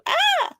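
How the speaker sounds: a sudden squeaky high yelp, mocking; mock shocked yelp. Locals mocking the American tasting haggis, high mock yelp. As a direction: playfully imitate a tourist's startled cry. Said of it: "they were like".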